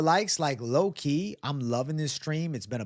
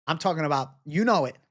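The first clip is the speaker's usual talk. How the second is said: ordinary talk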